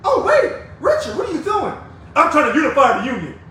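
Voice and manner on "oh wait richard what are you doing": much higher, mock alarm at himself; mock self-scolding. Scolding himself in front of viewers, high mock alarm. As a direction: catch yourself, playfully shocked.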